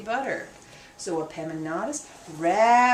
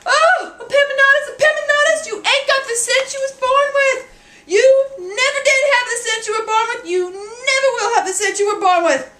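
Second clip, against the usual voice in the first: high, loud despairing scold; scolding mammy; 11 semitones higher, 7 dB louder than usual. Acted. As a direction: wail at the hopeless boy. Mammy scolding, laughing, shouting.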